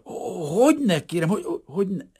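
stammering obedient agreement. A narrator's reading. Refugee stammering obediently to the official. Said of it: "hebegte ilyetten is engedelmesen".

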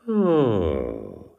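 long falling mocking "oh"; drawn-out teasing exclamation; biting sarcasm. A narrator's reading. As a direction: needle him with a sliding "oh". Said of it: "szólt az elnök úr csípősen".

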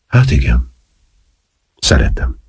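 a touch of shyness, low-pitched; almost bashful. A narrator's reading. Marcel admits to Valmier that he loves him, almost bashfully. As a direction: confess it a little coyly. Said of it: "csak nem szemérmesen mondta".

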